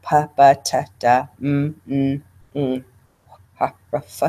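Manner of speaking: consonants said one by one; isolated consonant sounds; speech sound demo. Shown as a demonstration. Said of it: "these sounds that you see".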